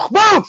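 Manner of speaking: high, loud impact yell; shouted boom. Retold or imitated. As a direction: belt it like a crash. Impact sound effect.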